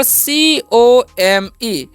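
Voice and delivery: slow, letter by letter; spelling out letters. Shown as a demonstration. Teacher spelling a word for beginners, slowly. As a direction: spell C-O-M-E out one letter at a time. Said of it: "तो इसे कॉमे नहीं पढ़ते हैं".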